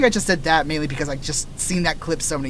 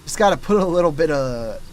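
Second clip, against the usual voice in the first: surfer dude voice; 4 dB louder than usual. Shown as a demonstration. Showing chat how a surfer voice goes, light surfer bro. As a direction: slide into a chill surfer dude. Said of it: "just look at any surfer movie and just replicate voice".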